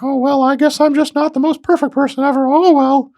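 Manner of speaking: sing-song schoolboy owning his flaws; fifties kid voice. Pretend.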